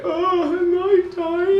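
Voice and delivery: high, slow, mock pitiful dying voice; high hammed-up dying whine; mock dying whimper. Mocking dying child character.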